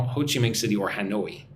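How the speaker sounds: normal talking voice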